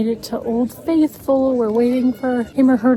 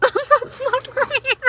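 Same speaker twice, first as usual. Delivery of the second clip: high, slow words through laughter; helpless laughing; 13 semitones higher, much slower than usual. Real, not acted. Laughing at own failed accent, helpless giggling speech. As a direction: crack up while talking.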